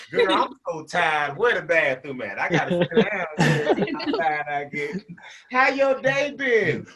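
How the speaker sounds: slow, laughing lady voice; imitating women. Women in the restroom, slow laughing imitation. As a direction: play tired women chatting on a couch. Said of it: "women in the restroom".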